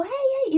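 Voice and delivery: loud, high-pitched "ay, ay"; retold scream. Dog's owner yelling after the truck hit her, loud high cry. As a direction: imitate yelling out in alarm. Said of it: "yo le empecé a gritar".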